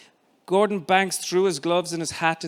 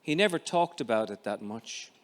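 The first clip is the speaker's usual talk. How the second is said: ordinary delivery, nothing marked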